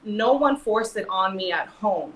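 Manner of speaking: ordinary talk, nothing marked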